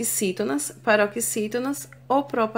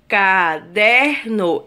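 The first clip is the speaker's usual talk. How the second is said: "Caderno" slow and loud, in three separate syllables; 3 semitones lower, 5 dB louder, much slower than usual. Shown as a demonstration. Teacher counting three syllables, syllable by syllable. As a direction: sound out "Caderno" one syllable at a time. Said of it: "na separação silábica".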